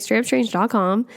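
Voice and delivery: quick, run-together web address; brisk and hurried; said fast. Real, not acted. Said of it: "I said that really fast".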